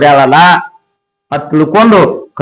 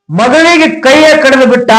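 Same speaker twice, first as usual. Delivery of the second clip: ಮಗಳಿಗೆ cried out much higher, in anguish; anguished cry; 9 semitones higher than usual. A narrator's reading. Preacher crying out the father's cruel deed, high anguished wail. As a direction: wail the line out high, as if it breaks your heart.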